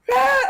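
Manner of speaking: high imitated cat yowl; imitated woman-like yell. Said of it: "it sounds like a woman yelling".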